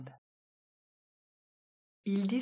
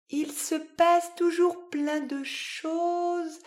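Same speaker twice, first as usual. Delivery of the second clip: slow, higher hesitant musing; 9 semitones higher, 3 dB louder, slower than usual. A narrator's reading. The cat Namastouille repeating the worm's lesson, slow and hesitant. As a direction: test the thought slowly, unsure. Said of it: "il ajoute hésitant".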